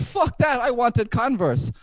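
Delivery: put-on girlfriend's outburst. Girlfriend voiced in story.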